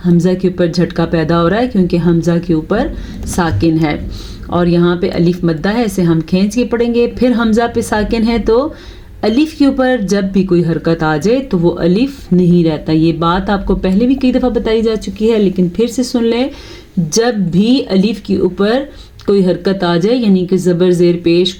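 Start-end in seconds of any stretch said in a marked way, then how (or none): none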